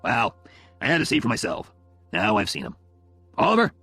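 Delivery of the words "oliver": high, loud, questioning call. Acted. Phil addressing his daughter's boyfriend, calling out. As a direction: call his name to get attention.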